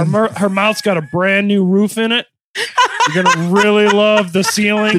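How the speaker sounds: loud, laughing salesman pitch; car salesman voice. Pretend. Mock salesman pitching wife.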